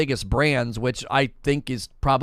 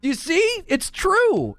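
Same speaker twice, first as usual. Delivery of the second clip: gleeful; 14 semitones higher than usual. Real, not acted. Gloating to chat.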